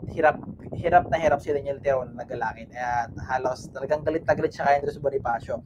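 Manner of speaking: normal talking voice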